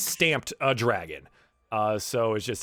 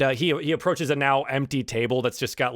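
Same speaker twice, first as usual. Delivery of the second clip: usual speaking voice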